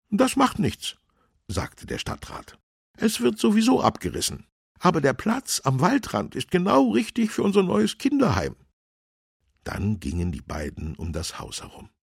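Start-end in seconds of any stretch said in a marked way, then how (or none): 0.1-1.0 very high, louder voice; councillor character. A narrator's reading. Councillor waves off the policeman's objection, high character voice. 3.0-8.6 high, loud, brisk councillor; brisk official pitched far above the narrator; councillor character. A narrator's reading. Councillor tells policeman his plan, high character voice.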